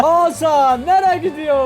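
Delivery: slow, drawn-out village headman calling out; a gruff old muhtar asking where Hasan goes; headman's voice. Acted. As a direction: play the muhtar questioning Hasan.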